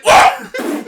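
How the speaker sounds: high, loud startled whoa; startled yelp. Retold or imitated. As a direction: jump at the touch. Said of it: "he's like".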